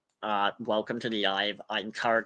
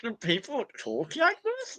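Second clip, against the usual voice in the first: much higher, mock nerd voice with a rising question; nerdy brother; 12 semitones higher, 3 dB quieter than usual. Streamer imitates her brother judging how she talks, high mock voice. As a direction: squeak it out like a baffled know-it-all. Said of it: "He'd be like".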